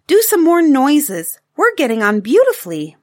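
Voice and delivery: high, loud, delighted urging; eager child. Acted. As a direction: egg Daddy on with glee. Taffy eagerly urges her father on.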